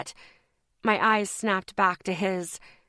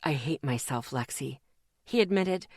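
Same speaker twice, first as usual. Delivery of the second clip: guilty, quiet confession; 6 semitones lower, 4 dB quieter than usual. A narrator's reading. Guilty confession, low and soft.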